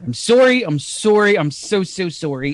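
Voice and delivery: mock Canadian apology with rounded "sorry"; hammed-up apologetic Canadian; Canadian accent. Shown as a demonstration. Performer apologizing over and over, mock Canadian accent.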